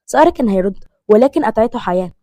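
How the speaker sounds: ordinary delivery, nothing marked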